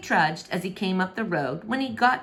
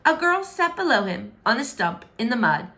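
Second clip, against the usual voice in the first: plain reading voice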